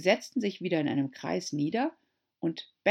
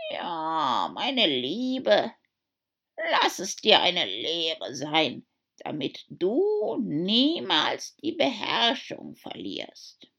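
put-on slow, lecturing old crab; old crab mother; 4 dB louder, much slower than usual. A narrator's reading. Old crab lecturing her daughter, slow. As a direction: preach a lesson slowly. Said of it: "eine alte Krabbe".

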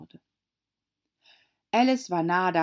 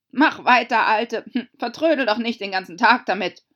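very loud, higher, brisk order; impatient Gryphon; 6 semitones higher, 10 dB louder than usual. Acted. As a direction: hurry the old one along loudly. Gryphon impatiently hurries Mock Turtle. (ungeduldig, laut und forsch)

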